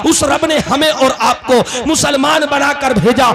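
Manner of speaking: high, shouted sermon delivery; impassioned preaching. Acted. Preacher telling the crowd of God's great favour, impassioned preaching. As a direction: preach it in ringing, driving phrases.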